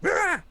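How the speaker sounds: sudden high startled shout; startled yell. Pretend. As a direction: yelp in surprise. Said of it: "turn around and be like".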